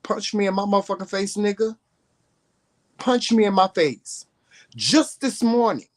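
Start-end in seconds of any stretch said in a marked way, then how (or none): 0.0-4.2 higher, fast dare to punch him; taunting dare. Real, not acted. Daring online haters to hit him, taunting.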